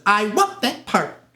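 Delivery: high, loud, drawn-out demand; insistent demand. Retold or imitated. Actress at the studio front office demanding a part, insistent. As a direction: stake your claim on every word, slowly.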